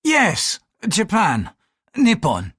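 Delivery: high-pitched, impatient priest; short impatient words, pitch jumping up. A narrator's reading. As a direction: answer as if pestered by a stupid question. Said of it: "the priest said impatiently".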